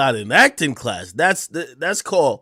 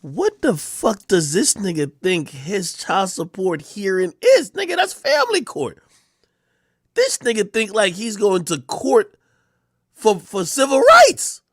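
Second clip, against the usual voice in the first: higher and slow, in disbelief; incredulous mockery; 6 semitones higher than usual. Real, not acted. Host mocking the hearing, rising in disbelief. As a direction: scoff at the idea, pitch climbing.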